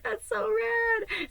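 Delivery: very high, slow drawn-out thrill; gushing delight. Retold or imitated. Hearing Edward wants a new name, she gushes in delight. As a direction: stretch it out, over the moon.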